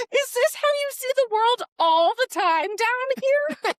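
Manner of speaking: high, wailing hammed-up dismay; mock tragic. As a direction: lament like the world is ending. Exaggerated retold dismay.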